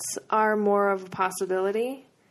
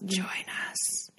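eerie whispered invitation; haunted house actor; 8 dB quieter than usual. Pretend. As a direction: whisper it like a ghost beckoning. Creepy invitation to join group.

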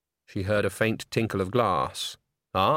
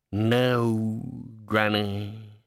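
sulky cat; much slower than usual. A narrator's reading. Cat escort answers sulkily.

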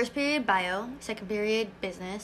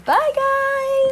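goodbye lilted much higher and louder; sing-song goodbye; 7 dB louder than usual. Real, not acted. Podcast host signs off to listeners, sing-song. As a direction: wave the listeners off brightly.

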